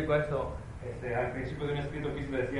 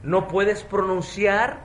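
loud, high, forceful preaching; żarliwe kazanie; 10 semitones higher, 15 dB louder, slower than usual. Real, not acted. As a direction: drive each phrase home to the congregation.

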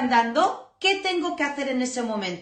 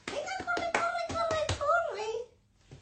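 much higher, soft, sing-song coaxing; playful high call; 8 dB quieter, much slower than usual. Retold or imitated. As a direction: cheer the puppy on like a game. Encouraging puppy to run over.

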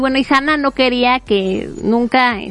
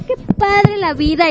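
carefree cheer; 7 semitones higher than usual. Retold or imitated. Hiroto acts like a star.

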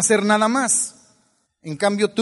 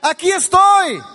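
much higher, louder, slow triumphant cry; newborn's proud shout; 8 semitones higher, 5 dB louder, slower than usual. Pretend. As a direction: announce yourself to the world like a newborn.

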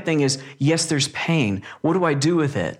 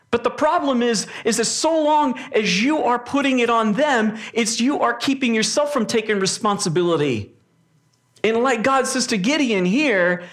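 higher-pitched, urgent preaching; earnest sermon delivery, pitched up; 6 semitones higher than usual. Acted. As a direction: press the point home from the pulpit. Raised preaching passage.

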